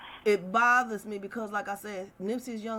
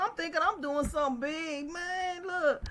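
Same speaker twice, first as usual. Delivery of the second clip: usual speaking voice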